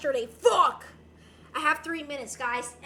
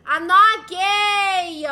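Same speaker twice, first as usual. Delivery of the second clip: mock loud denial; 2 semitones higher, 13 dB louder, much slower than usual. Loud slow joke denial.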